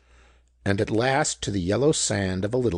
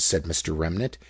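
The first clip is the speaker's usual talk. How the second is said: plain reading voice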